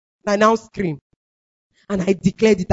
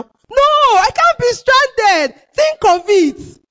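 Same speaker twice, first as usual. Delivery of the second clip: high, loud, drawn-out refusal; defiant outburst; 12 semitones higher, 4 dB louder, much slower than usual. Retold or imitated. Woman refusing to be stranded alone in her room, crying out defiantly. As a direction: cry it out slowly in protest, high and loud.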